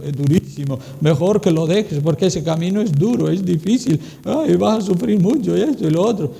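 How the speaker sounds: lightly voiced tempting devil; a hint of the devil. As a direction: coax them to quit, sly. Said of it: "Y el diablo le dice". (diablo tentador, suave y astuto)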